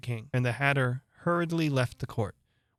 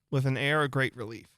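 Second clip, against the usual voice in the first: ordinary delivery, nothing marked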